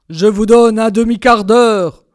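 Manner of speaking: loud, implacable villain voice; booming stern husband; stern Bluebeard. A narrator's reading. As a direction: grant it grudgingly, with force. Bluebeard grants wife brief delay.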